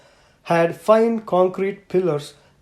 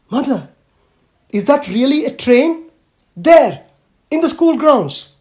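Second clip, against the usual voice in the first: much higher and louder, breathless amazement; can't believe what she's seeing; astonished little girl; 8 semitones higher, 7 dB louder than usual. Acted. Little girl calling to Mother about the train, astonished.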